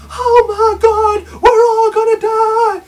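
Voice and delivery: high, loud, slow hysterical wail; hammed-up panicked scream; mock panic. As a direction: shriek it like a terrified leader. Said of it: "he'll say".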